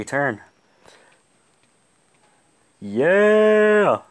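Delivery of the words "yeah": loud high victory shout; triumphant yell. Real, not acted. Gamer yelling at his screen after a kill, loud high triumphant shout. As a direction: roar it out after the win.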